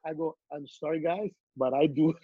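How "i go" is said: normal talking voice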